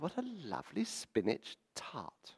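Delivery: high, drawn-out, softly marvelling coo; mock-gush in a high voice, stretching the words; gushing dinner guest. Dinner guest admiring the spinach tart, gushing.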